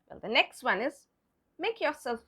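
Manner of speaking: normal talking voice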